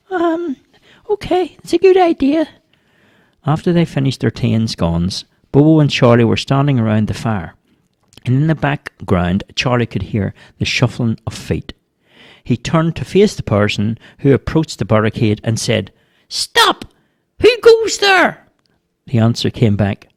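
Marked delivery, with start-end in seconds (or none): 0.1-2.5 put-on very high squeaky child voice; hesitant little boy. A narrator's reading. Boy accepting his friend's advice, hesitant. Boy Charlie answers Bobo. 16.3-18.5 squeaky, very high little challenge; boy's challenge. A narrator's reading. Boy challenging a stranger at the barricade, squeaky.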